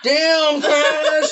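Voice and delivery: squealed, very high mock exclamation; comic falsetto outcry, stretched out; Eugene. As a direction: imitate a buddy shrieking in disbelief. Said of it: "And Eugene goes".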